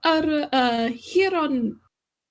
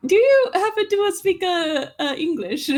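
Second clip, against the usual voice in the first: put-on halting, unsure Forcenanian accent, starting higher; shaky Forcenanian; 6 semitones higher than usual. [name]'s character nervously asks receptionist in halting Forcenanian accent. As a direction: stumble through it in shaky Forcenanian. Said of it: "in very, very shaky Forcenanian".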